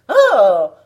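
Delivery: very loud outraged cry; the wicked queen's roar of rage; fit of rage. A narrator's reading. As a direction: explode with a furious "Oh!". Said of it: "s'écria la reine dans un accès de rage".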